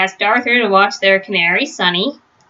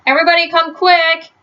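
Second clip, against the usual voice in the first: calling out; 7 semitones higher than usual. A narrator's reading. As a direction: summon everyone at once. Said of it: "called Arthur".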